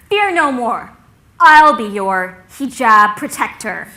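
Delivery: loud, slow, grand declaration; heroic vow. Retold or imitated. Logan vowing to protect his friend, heroic vow. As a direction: proclaim it like a gallant protector.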